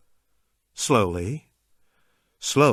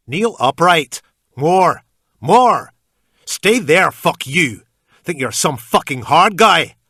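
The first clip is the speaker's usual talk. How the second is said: loud, high-pitched ranting yell; furious shout; 7 semitones higher, 10 dB louder, slower than usual. A narrator's reading. Captor screaming orders at the man on his knees, furious. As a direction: bark the orders at him, raging. Captor yelling at kneeling narrator.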